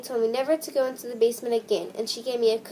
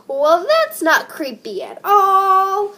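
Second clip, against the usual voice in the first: high, loud, slow sarcasm; mock-casual sarcasm after a gruesome tale; sarcastic; 8 semitones higher, 9 dB louder, much slower than usual. Real, not acted. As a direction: drag it out mockingly. Sarcastic after gory story.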